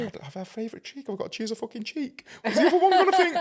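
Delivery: high, laughing mock outrage, rising to a loud squeal; laughing mock panic. Mocking her own yoga-class worry, laughing high mock outrage.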